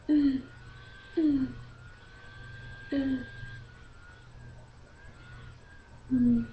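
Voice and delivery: short, high, soft whimpers; mock whimpering. Imitating the crying kid's whimpers.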